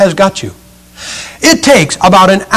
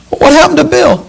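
very high, wide-eyed acted question; high-pitched alarm; 11 semitones higher than usual. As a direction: ask in mock alarm, pitch way up. Acted alarmed question about acorn's buddy.

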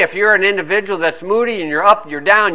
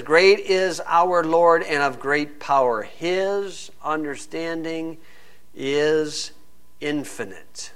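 solemn scripture reading; 3 semitones lower, 8 dB quieter, slower than usual. Acted.

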